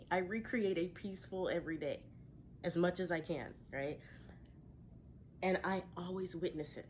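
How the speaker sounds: ordinary talk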